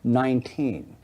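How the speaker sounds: slow, low-volume demonstration with clear gaps. Shown as a demonstration. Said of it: "let me say it slower, and I'm gonna lower my voice".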